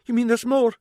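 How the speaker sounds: high, shaken gasp of disbelief; a startled old lady, voice jumping up; shocked. Acted. Fraser startled by the detective's news, shocked and high. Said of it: "almost quivering with shock".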